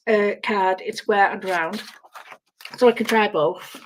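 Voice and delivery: normal talking voice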